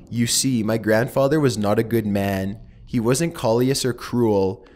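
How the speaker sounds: ordinary delivery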